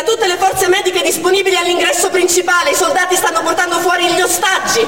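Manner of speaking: ordini gridati, più alti e forti; grido di ordini. Retold or imitated. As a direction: chiama i soccorsi a gran voce. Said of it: "Sakrasov finalmente grida". (Sakrasov grida ai medici di accorrere all'ingresso, urgente)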